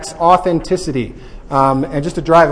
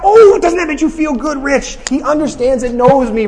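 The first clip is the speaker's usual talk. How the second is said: excited delight; 9 semitones higher, 3 dB louder than usual. Real, not acted. Relishes the verse excitedly.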